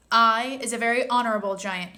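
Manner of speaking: proudly. Acted. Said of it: "raised his head proudly".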